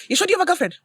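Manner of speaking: high, sudden awkward question; blurted out. Retold or imitated. Mom awkwardly asking son about dating, blurted out. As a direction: blurt it before you lose your nerve. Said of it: "Let me just blurt it out".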